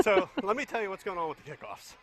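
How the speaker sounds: winded from the stairs; panting while talking; out of breath. Real, not acted. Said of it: "You ran up the steps".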